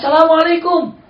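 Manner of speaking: high, loud call at the door; calling greeting. Retold or imitated. The Prophet calling his greeting at the master's door, loud.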